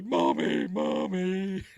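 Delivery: high, frightened cry for mommy; panicked whimper. Retold or imitated. Guy panics in the shuttle.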